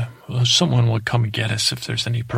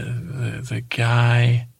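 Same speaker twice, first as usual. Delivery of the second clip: usual speaking voice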